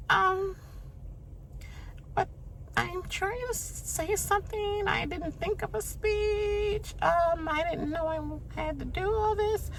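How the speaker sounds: high squeaky put-on voice, fake tears building; mocking Keisha's teary speech. Mocking Keisha choking up during her eviction speech, high teary voice. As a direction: parody a tearful farewell, high and breathy. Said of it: "all Keisha could come up and do was".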